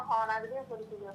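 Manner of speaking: ordinary delivery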